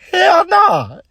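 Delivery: playful high-pitched refusal, imitating Tyler; Tyler's hell nah. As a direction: squeal it up high like you can't believe it. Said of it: "He said".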